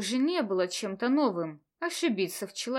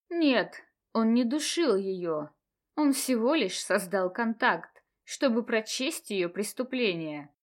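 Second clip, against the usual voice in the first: ordinary delivery, nothing marked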